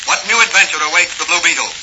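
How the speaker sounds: ordinary talk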